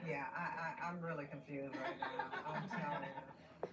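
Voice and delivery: giggling confusion at the news; laughing and confused. Retold or imitated. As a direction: chuckle through it. Chloe voiced, laughing.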